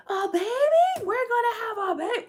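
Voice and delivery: put-on very high, squealy toddler voice; little girl voice. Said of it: "And Talani's like".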